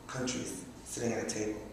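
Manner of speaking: ordinary delivery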